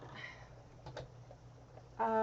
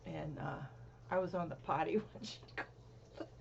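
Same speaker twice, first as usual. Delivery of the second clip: ordinary talk, nothing marked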